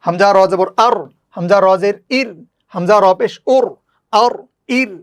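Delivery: rhythmic, patterned letter-by-letter recitation; spelling drill recitation. Shown as a demonstration. Teacher reads the whole exercise through for learners, rhythmic drill recitation. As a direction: recite each syllable set in the same measured tune.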